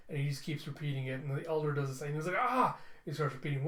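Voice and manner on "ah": high, loud mock scream; acted pained cry. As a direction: imitate a sudden stab of pain. Elder suffers the same.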